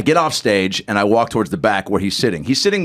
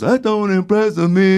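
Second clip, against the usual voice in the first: high, slow put-on star voice; slow high impersonation; 7 semitones higher, slower than usual. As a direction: draw the words out high, imitating a musician. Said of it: "He goes".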